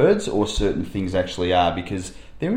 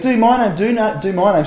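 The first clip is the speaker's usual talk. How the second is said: put-on eager kid begging; 9 semitones higher, 5 dB louder than usual. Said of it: "and so he went".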